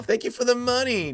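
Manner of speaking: high-pitched hammed-up greedy voice; mock gloating. Streamer pretending greed over a donation, high mock gloating.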